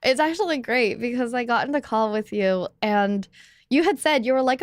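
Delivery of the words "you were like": normal talking voice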